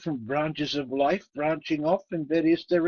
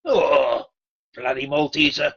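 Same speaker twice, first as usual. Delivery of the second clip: usual speaking voice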